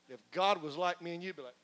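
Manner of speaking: usual speaking voice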